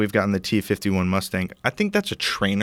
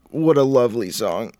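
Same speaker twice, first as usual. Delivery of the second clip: higher, put-on voice for mock admiration; 3 semitones higher, 3 dB louder than usual. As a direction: gush over the song in an affected voice. Theatrical praise of the chosen song.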